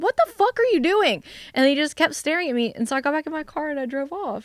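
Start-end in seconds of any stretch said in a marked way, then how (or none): 0.0-1.2 high-pitched, bewildered demand; exasperated disbelief. Retold or imitated. Driver to the man who just stands staring, exasperated high demand. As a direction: demand an explanation, voice pitched right up.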